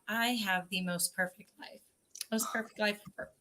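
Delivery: a private, blissful thought to yourself; quiet musing monologue; dreamy inner thought. Retold or imitated.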